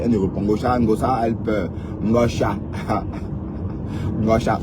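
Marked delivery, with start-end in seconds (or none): 2.6-4.2 stifled airy laugh under the breath; breathy laugh. Real, not acted. Streamer mocking a rival who cries, stifled breathy laugh. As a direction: snicker silently through the nose.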